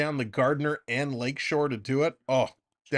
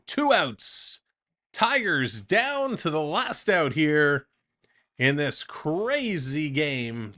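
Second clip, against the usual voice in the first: lively baseball announcer delivery; play-by-play call; slower than usual. Acted. Streamer calling the Jays game for chat, announcer voice. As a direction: call the at-bat like a broadcast.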